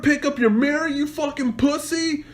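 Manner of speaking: high, fast jeering question; taunting challenge. Retold or imitated. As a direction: rattle off a high-pitched retold taunt. Acts out taunting the driver.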